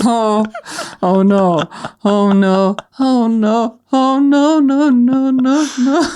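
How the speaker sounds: building from worried to high panic; rising panicked no. Real, not acted. Panicking at the smell.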